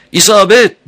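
loud, high-pitched cry; shout. A narrator's reading. Amber announcing the hit, shouting. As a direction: yell it out at full pitch. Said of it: "diye bağırdı Amber".

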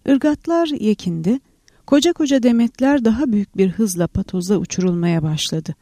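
plain reading voice

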